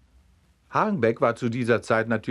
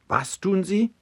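sharply higher, startled question; astonished cry; 10 semitones higher than usual. A narrator's reading. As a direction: caught completely off guard, calls out. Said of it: "rief Hagenbeck". (hoch und verblüfft gerufen)

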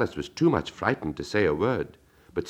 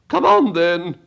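much higher, louder imperious Queen; 12 semitones higher, 7 dB louder, slower than usual. Acted. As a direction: demand it of Alice. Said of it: "said the Queen".

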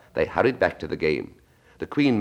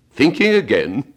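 higher, louder, slow teasing question; Duchess prodding; 7 semitones higher, 7 dB louder, slower than usual. Acted. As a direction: needle her with a sly question. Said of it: "the Duchess asked".

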